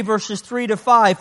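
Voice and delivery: ordinary talk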